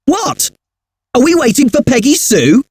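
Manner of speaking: "What?" jumps high as a surprised question, then a pause, then "Are we waiting for Peggy Sue?" rising at the end; a sharp high "What?" on its own, then the rest as a plain rising question; pause after What. Shown as a demonstration. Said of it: "I paused and put the emphasis in different places".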